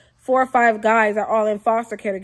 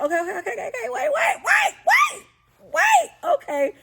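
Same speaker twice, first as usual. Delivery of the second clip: rising higher and higher into a shouted wait; frantic stop; 13 semitones higher than usual. Retold or imitated. Narrator stepping between two girls, frantically shouting wait. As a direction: panic climbing as you try to break it up.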